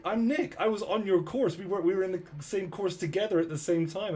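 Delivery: high, eager quote of a reunited classmate; excited reintroduction, imitated; retold excited recognition.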